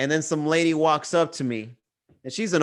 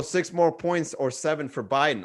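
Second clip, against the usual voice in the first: ordinary talk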